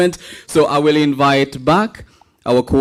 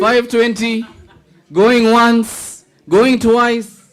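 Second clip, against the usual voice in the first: laughing auctioneer chant, pitched high; going once, going twice, with a laugh; auctioneer call; 7 semitones higher, slower than usual. Pretend.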